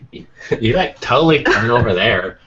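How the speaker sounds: put-on airy valley girl GPS voice; like, totally, as a valley girl navigator. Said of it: "a Valley Girl accent".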